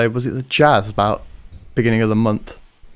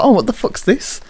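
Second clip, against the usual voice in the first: surprised; 10 semitones higher than usual. Retold or imitated. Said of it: "I was like".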